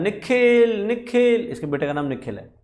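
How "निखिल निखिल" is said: put-on high calling shout for a lost child; calling out loud. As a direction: call the name out high and loud down the street. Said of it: "ज़ोर ज़ोर से चिल्ला रहा है".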